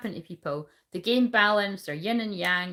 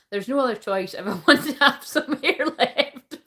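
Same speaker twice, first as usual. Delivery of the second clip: reads the comment aloud, high and loud, breaking into laughter; can barely get the words out for laughing; laughing while reading; 5 semitones higher, 6 dB louder than usual. Real, not acted. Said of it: "I'm in danger of peeing myself".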